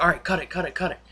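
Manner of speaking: acting out a hurried command; retold urgent call.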